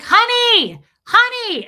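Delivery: high, loud repeated call; retold screaming. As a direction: holler to get a partner's attention. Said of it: "And I'm screaming".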